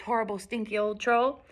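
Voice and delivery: ordinary delivery, nothing marked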